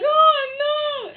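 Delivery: high, loud mock wail of dismay; horrified mother. Mother reacting to her daughter's cheeky answer, horrified.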